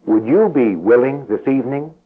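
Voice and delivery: ordinary talk, nothing marked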